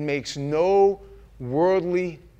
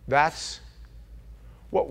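usual speaking voice

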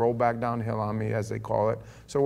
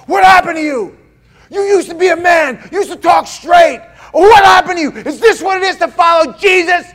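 much higher, much louder furious yelling; furious shout; 21 dB louder than usual. Retold or imitated. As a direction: scream it at him like a challenge. Friend shoves him, yelling.

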